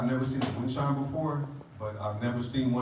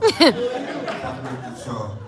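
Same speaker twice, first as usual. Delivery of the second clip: louder, slower Talk to me after the show; louder, slower repeat; 7 dB louder, slower than usual. Acted. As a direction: hammer the callback slowly.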